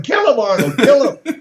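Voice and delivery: retold yell, pitched high. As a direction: imitate a friend cheering on a fight. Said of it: "He's just yelling".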